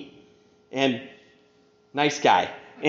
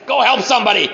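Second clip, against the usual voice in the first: higher barked order, mock gruffness; imitated bark; 6 semitones higher, 4 dB louder than usual. Sponsor to sponsee before hanging up, mock bark. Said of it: "He'd say, bark something at me".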